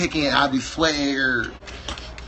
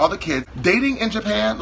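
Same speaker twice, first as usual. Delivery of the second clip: ordinary talk, nothing marked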